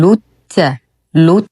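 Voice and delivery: لت with short u straight onto held ت; short u into doubled ta. Shown as a demonstration. Teacher modelling the join, لت with doubled ta. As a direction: clip the u of لت into a doubled t. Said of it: "ننتقل من ضمة اللام إلى تشديد التاء".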